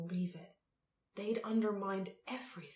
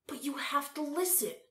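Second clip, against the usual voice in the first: high-pitched urgent appeal; urging; 8 semitones higher than usual. A narrator's reading. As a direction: urge them to listen. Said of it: "urged Tal".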